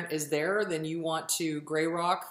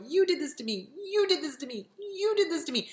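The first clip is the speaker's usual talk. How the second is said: blaming voice; 9 semitones higher than usual. Retold or imitated. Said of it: "'cause every time you go".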